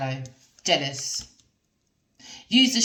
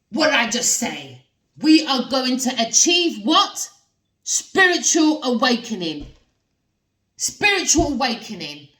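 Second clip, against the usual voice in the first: excited; 5 semitones higher, 6 dB louder than usual. Real, not acted. Excited reveal to viewers.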